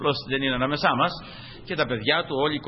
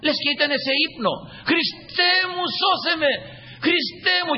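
crying out; 12 semitones higher, much slower than usual. Retold or imitated. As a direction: call out to Christ, overcome. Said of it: "άρχισε να κλαίει". (φωνή ικεσίας με κλάμα)

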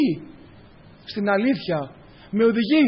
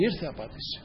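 much softer, lower, almost to himself; hushed echo; 7 semitones lower, 13 dB quieter than usual. Acted. Preacher echoing God's answer softly to the congregation, hushed and low. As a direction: drop ήρθε απάντηση to a quiet, low murmur.

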